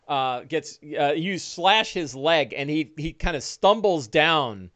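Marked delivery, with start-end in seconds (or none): none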